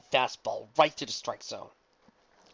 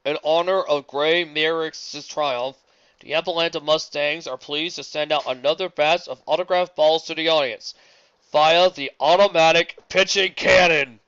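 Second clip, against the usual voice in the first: stadium announcer; 4 semitones higher, 10 dB louder than usual. Acted. Announcer addressing the stadium crowd, booming announcement. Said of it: "the announcer cried".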